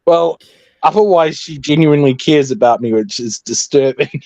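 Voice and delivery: ordinary delivery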